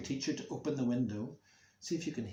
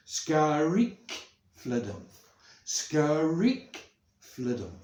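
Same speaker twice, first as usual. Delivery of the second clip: loud drawn-out squeal, short falling answer; creaking swing; 4 semitones higher, 7 dB louder, much slower than usual. Shown as a demonstration. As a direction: swing back and forth with the voice. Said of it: "Join in".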